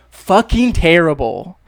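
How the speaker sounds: "Fucking terrible" slow and loud, leaning hard on "Fucking"; emphatic swear. Shown as a demonstration. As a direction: punch out "Fucking terrible" like you mean it. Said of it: "what can you say instead of "very bad"?".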